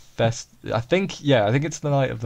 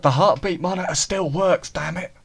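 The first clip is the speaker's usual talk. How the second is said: insistent character quote; 6 semitones higher than usual. Retold or imitated. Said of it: "She's like".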